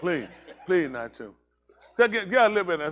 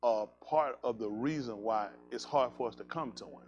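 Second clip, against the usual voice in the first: quiet sincere sermon; earnest sermon; 8 semitones lower, 10 dB quieter than usual. Acted. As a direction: lay it out gently to the congregation. Preacher turns to teaching.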